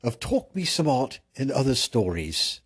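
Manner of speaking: high, loud, theatrical British announcer; mock British host. Host opening a special premiere edition, mock British. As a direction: introduce the show in a hammed-up British accent.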